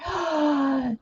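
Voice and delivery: a sharp, thrilled gasp of delight; excited gasp. Real, not acted. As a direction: gasp with happy surprise. Thrilled by friend's plan.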